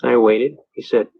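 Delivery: ordinary delivery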